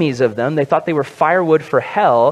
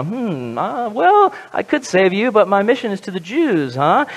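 lightly mock pondering, hemming and hawing higher; textbook answer; 3 semitones higher than usual. Preacher imitating Jesus answering her request, mock textbook answer. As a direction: put on a mild, offhand official brush-off. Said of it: "he responds to her request with just the textbook answer".

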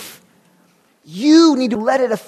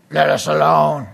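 demon impersonation; 7 semitones lower than usual. Acted. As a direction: cry it out as a low demon. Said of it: "that's my best demon impersonation".

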